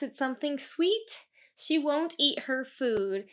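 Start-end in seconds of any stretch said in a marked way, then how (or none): none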